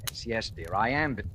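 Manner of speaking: usual speaking voice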